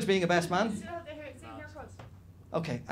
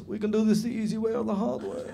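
higher, mock Eastern European cop threat; Eastern European accent; 5 semitones higher than usual. Fake cop threatening the stag, Eastern European accent. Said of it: "this is my Eastern European accent".

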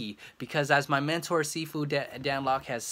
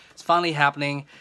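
louder, thrilled burst; excited; 3 semitones higher, 8 dB louder, faster than usual. Real, not acted. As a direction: blurt it out with joy. Repeats the news twice.

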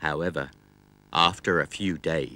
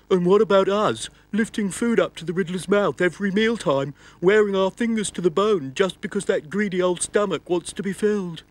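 high complaining voice; hands voice; 12 semitones higher than usual. Acted. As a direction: whine about the greedy stomach. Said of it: "said the riddler's hands".

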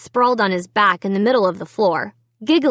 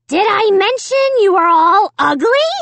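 high, loud puppet jeer; gloating dummy; 10 semitones higher, 5 dB louder than usual. A narrator's reading. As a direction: crow the insult as an evil dummy. Dummy delivers final insult.

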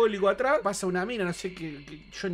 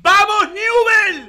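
slow, loud, high fan cry; stretched stadium shout; football cheer; 11 semitones higher, 8 dB louder, much slower than usual. Real, not acted. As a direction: chant it like the stands.

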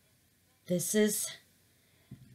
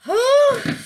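high, loud whew of relief; a big sigh of relief, pitched high; loud relieved whew; 8 dB louder than usual. Real, not acted. Relief after torching.

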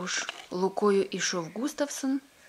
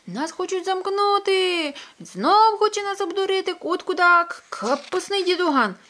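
much higher and louder, squawking in panic; panicked hens; 11 semitones higher, 9 dB louder than usual. Acted. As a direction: shriek it like hens in a flap. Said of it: "залементували".

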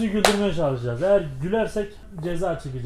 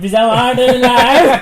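helium; 4 semitones higher, 13 dB louder, much slower than usual. Real, not acted. Helium voice laughing.

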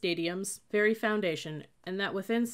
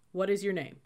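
plain reading voice

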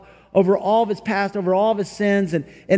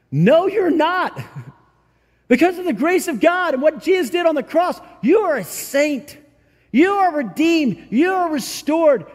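full-force preaching; 7 semitones higher than usual. Acted. Preacher declaring to the congregation who they are, booming.

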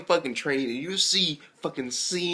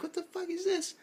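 much higher, squeaky disbelief; high shocked outburst; 6 dB quieter than usual. Retold or imitated. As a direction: jump way up in amused shock. Reacting to romantic scene.

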